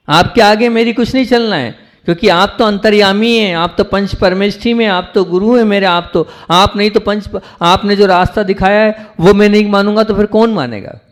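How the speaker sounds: high-pitched, earnest avowal; fervent devotion. Acted. Disciple tells the guru he will follow his path, fervently devoted.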